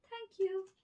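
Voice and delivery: very high, loud squeak; squeaky mouse. A narrator's reading. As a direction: squeak thanks like a tiny mouse. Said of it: "squeaked the mouse".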